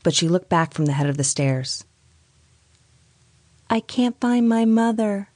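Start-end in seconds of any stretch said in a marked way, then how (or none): 3.7-5.3 put-on high, slow, otherworldly child voice; a lost little ghost, drawn out and high; eerie ghost child. A narrator's reading. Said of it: "never heard such a voice".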